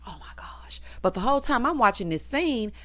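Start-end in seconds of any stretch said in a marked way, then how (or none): 0.1-0.8 breathy, panicked whisper; mock hyperventilating. Host imitating Zach panicking in the back, hyperventilating whisper. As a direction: whisper it as if short of air. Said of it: "hyperventilating like".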